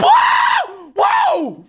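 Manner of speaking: very high, loud whoop; overexcited yell. Real, not acted. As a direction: burst out, way too excited. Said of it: "I'm getting way too excited".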